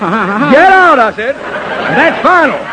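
enraged colonel yelling get out; furious shout. Acted. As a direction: scream him out of the room. Colonel ordering the impostor out.

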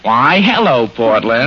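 lightly impersonated radio comedian; hint of a droning comic host; Fred Allen. Shown as a demonstration. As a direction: greet her as the show's host would. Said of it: "to have an artist impersonate me".

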